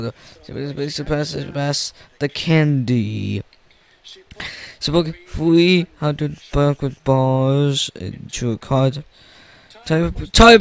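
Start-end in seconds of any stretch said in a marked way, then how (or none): none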